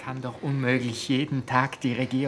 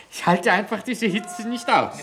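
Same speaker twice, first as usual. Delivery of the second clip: louder, higher, cracking under the heat; overwhelmed outburst; 8 semitones higher, 7 dB louder than usual. Acted. Woman overcome by heat, crying out desperately to all. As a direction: blurt it out, at the end of her rope.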